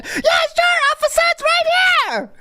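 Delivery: nervous squeaky high voice to a cop; high-pitched nervous voice. Pretend. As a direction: voice jumps high in panic. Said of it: "my voice goes high when I'm in shock".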